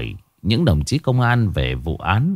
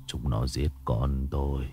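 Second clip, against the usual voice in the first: much lower, much softer, tearful monologue; small murmur; 8 semitones lower, 13 dB quieter than usual. A narrator's reading. Grieving father murmurs to himself in tears. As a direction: talk to yourself through tears. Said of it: "giọng nói nho nhỏ của ông".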